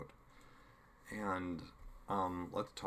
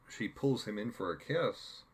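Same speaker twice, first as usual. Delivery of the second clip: usual speaking voice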